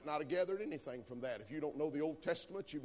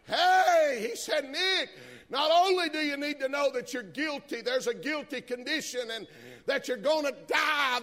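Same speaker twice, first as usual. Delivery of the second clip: high, loud preaching, slow on the big words; preaching at full force; 12 semitones higher, 15 dB louder, slower than usual. Acted. Preacher shouting good news to the congregation, high and loud.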